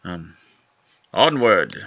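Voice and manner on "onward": rallying cry. Real, not acted. Rallying after the fight.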